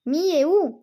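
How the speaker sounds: a lightly voiced, distant mysterious question; eerie spirit call. Spirit calls again.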